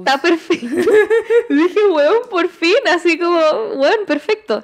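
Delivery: loud, high excited glee; loud giddy delight. Retold or imitated. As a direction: squeal it with joy. Excited retelling of perfect match.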